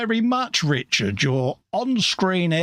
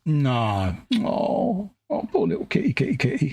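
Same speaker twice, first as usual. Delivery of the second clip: high, put-on pitying coo over a poor little kitty; hammed-up pity for a dead kitten; mock grief over kitty; 4 semitones higher than usual. Co-host mourning the imaginary dead kitten, in hammed-up high pity.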